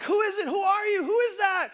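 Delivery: high, loud, startled demands; panicked questioning. Acted. Hanging man answers an unknown voice, high panicked shouting. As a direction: fire off frantic questions at an unseen voice.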